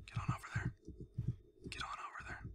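breathy whispered coaxing; sneaky whispered nudge. Acted. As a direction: whisper it so nobody hears. Whispering to a villager.

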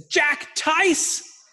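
high, loud mock shout of a brother's name; frustrated kid yell. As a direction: yell at your brother like a fed-up little boy. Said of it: "and he said".

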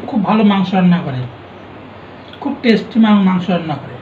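normal talking voice

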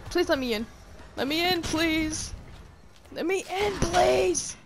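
high, loud pleading; mock-desperate plea. Player begging at the church door, loud play-pleading. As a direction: plead loudly to be let in. Begs game to let her in.

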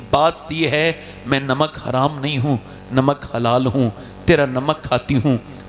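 humble sincerity. Retold or imitated. Daughter answering her father, low sincere voice. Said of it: "कहने लगी".

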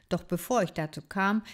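plain reading voice

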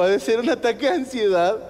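high, loud put-on doctor voice; derisive, hammed-up diagnosis; mocking doctor. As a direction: jeer the diagnosis at the patient. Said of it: "Como diciendo, "Pinche puto".

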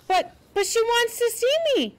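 high, urgent plea; pleading. A narrator's reading. Girl begging Marilla to let her see a friend, pleading. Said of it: "pleaded Anne".

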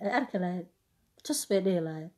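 normal talking voice

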